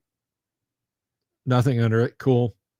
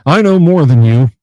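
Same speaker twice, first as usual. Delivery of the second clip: much louder flat put-down; blunt retort; 4 semitones higher, 13 dB louder than usual. Retold or imitated. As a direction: slam the clerk with it. Ron's retort to the clerk.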